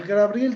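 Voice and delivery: "Gabriel" said as gra-BRIEL in a high voice; metathesis pronunciation. Shown as a demonstration. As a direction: slip an R after the G of Gabriel. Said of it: "palabra con metatesis".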